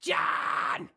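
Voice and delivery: loud unvoiced scream on done; short scream demo. Shown as a demonstration. Coach showing the student a short scream. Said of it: "I can still do ah, done".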